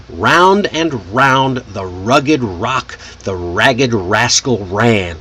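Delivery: tongue twister taken very slowly, each R drawn out; slow, exaggerated Rs. Shown as a demonstration. Teacher demonstrating a fun tongue twister, slow and exaggerated. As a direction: say it slowly, leaning into every R. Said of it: "Say it slowly at first, exaggerating each R".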